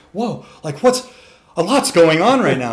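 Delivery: loud high startled whoa; stunned horse. Retold or imitated. Horse reacting to being punched, loud startled voice. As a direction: play the dazed horse after the punch. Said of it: "and he's like".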